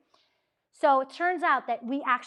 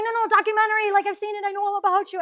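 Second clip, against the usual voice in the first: much higher and louder eager insisting; 9 semitones higher, 8 dB louder, faster than usual. Retold or imitated. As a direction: plead excitedly, like a fan. Insisting she knows them.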